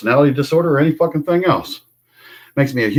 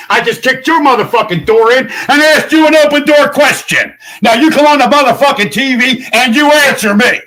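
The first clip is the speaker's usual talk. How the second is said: raised, loud, furious yelling; furious shout; 9 semitones higher, 5 dB louder than usual. Real, not acted. Ranting at the governor, raised furious shout. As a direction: yell it like you just kicked the door in.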